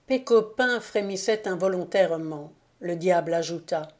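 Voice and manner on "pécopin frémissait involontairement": usual speaking voice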